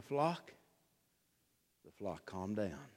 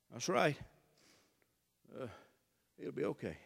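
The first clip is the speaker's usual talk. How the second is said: ordinary talk, nothing marked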